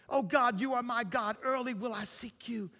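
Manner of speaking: soft, reverent psalm recitation; tender, loving. Acted. David tenderly talking to God, soft and loving. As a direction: speak softly and lovingly to God. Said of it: "talked about God so tenderly and so lovingly".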